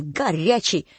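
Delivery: usual speaking voice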